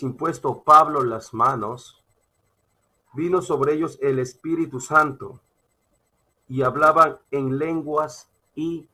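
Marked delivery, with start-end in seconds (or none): none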